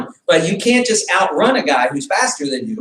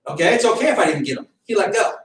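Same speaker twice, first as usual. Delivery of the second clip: ordinary talk, nothing marked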